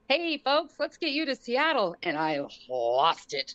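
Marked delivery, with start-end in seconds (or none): 0.1-1.9 upbeat pilot voice, pitched up; friendly captain greeting the family; cheerful pilot. Retold or imitated. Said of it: "he goes".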